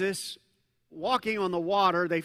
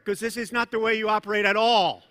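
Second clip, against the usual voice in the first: ordinary talk, nothing marked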